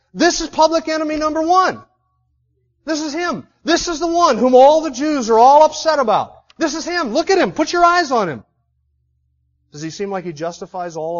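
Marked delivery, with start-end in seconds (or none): 0.1-8.4 much higher, loud pointing declaration; proclaiming Festus. Acted. Festus presenting the prisoner to the court, loud and scornful. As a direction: parade him before the court. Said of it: "Festus stands up and says".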